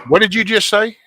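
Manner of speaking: can't believe what he just heard; stunned disbelief. Real, not acted.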